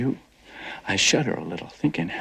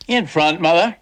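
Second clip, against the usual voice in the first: calling out; 8 semitones higher, 6 dB louder than usual. Acted. Son calls back to mother.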